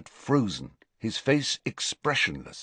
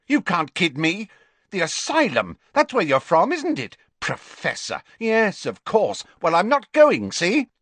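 put-on high-pitched, agitated young Riddle; angry, defiant outburst at a higher pitch; furious; 10 semitones higher, 4 dB louder than usual. A narrator's reading. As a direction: lash out in furious suspicion. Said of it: "looking furious".

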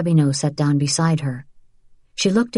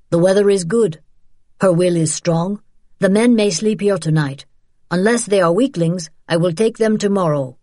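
Eduardo, brisk and decisive; 3 semitones higher, 3 dB louder than usual. Acted. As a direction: guide announcing his decision firmly.

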